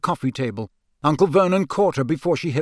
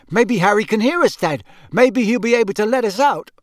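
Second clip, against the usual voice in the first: put-on high, raised young voice; urgent suggestion; 8 semitones higher, 5 dB louder than usual. Trapped son to his dad in the chimney, urgent. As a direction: pipe up urgently. Trapped boy pleads with father.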